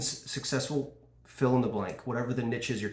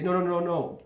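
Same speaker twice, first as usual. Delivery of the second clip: hard no, raised and louder, shutting them down; the wrong way, a loud defensive no; pushy refusal; 6 semitones higher, 4 dB louder than usual. Shown as a demonstration. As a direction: cut the prospect off with repeated nos. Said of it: "you don't say".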